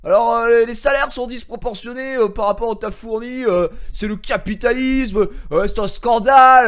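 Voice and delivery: strained mock voice of an angry twitter guy; mocking indignant guy. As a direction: ham up a self-righteous rant about capitalism. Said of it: "Un mec qui me dit".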